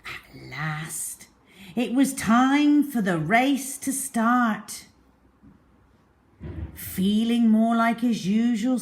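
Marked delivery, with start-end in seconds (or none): none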